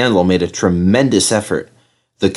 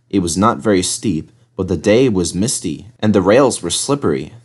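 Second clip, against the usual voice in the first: plain reading voice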